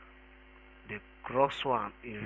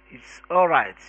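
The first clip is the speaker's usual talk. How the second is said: much louder, exasperated burst; angry explanation; 10 dB louder than usual. A narrator's reading. Older philosopher correcting his partner, angry and loud. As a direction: cut the partner off angrily, loudly. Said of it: "he explained angrily".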